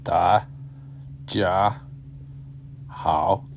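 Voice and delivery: 大 家 好 said one slow syllable at a time. Shown as a demonstration. Teacher sounding out 大家好 to learners, slowly. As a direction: break 大家好 into separate syllables with pauses.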